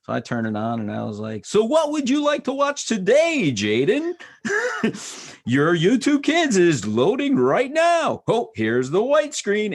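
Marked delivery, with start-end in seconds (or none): 1.4-4.1 put-on high, loud game-show host offer to a child; hammed-up host voice. Dad hamming it up for son. 5.5-9.7 high, slow, loud mock announcer; silly announcer. Dad playing announcer for son.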